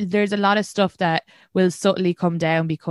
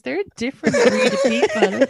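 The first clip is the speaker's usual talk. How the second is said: mock exasperation; 14 semitones higher than usual. Teasing about Irish Americans.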